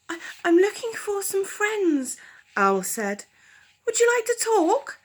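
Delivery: high, eager owl voice; hopeful little owl asking. Acted. Owl asking the badger to talk, high and hopeful.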